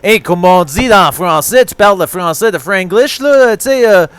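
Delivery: hammed-up Quebecois French accent; lively mock French-Canadian accent. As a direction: play an indignant Quebecois Frenchman. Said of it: "Quebecois, okay".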